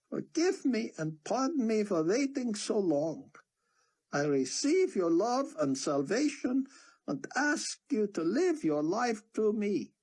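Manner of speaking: high, earnest prayer voice; preacher leading prayer. A narrator's reading. Sion leading the crowd in a sinner's prayer, high. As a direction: lead them in prayer, earnest.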